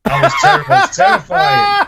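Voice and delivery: laughing loud and high. Real, not acted. Co-host cracking up after the coughing fit, laughing loud and high. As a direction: blurt it out mid-laugh.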